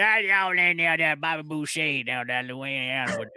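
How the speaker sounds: hammed-up Louisiana country accent; mock Louisiana accent, rambling along; Farmer Fred. Shown as a demonstration. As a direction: do a bayou farmer's Louisiana twang. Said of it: "So I do like Farmer Fred".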